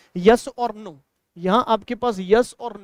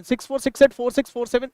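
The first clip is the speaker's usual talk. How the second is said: fast run of the digits six four six एट; quick number reading; faster than usual. Shown as a demonstration. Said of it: "संख्या को फटाफट फटाफट पढ़ना है".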